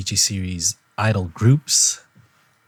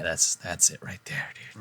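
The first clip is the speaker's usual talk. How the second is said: whisper; 3 semitones lower, 8 dB quieter than usual. Real, not acted. Whispered praise of candy.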